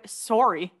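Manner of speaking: put-on sorry said as "sore-ee" in a Canadian accent; Canadian "sorry" with a rounded o. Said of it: "like, a Canadian accent now?".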